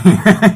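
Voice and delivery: high, loud burst of laughter. Real, not acted. A salesman laughing out loud at his own story.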